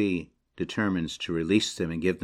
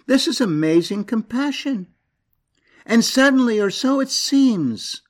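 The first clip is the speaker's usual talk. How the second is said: high, loud impassioned preaching; full-force preaching; 9 semitones higher, 3 dB louder than usual. Acted. Preacher stirring the congregation about God's love, fervent. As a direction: preach it out, climbing with passion.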